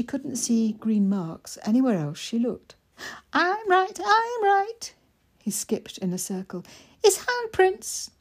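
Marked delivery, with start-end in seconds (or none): 3.3-4.9 high, sing-song and pleased; gloating little boy. Acted. Mikey skipping in a circle, gloating. 7.0-8.1 put-on high, eager small boy; little boy voice. Mikey asking his sister, high and eager.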